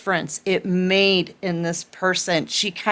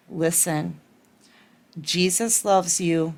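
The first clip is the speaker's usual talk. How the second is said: gently reassure, with unhurried pauses; calm cooing; 2 semitones lower than usual. Retold or imitated. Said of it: "very calmly cooing her, wh-, whispering".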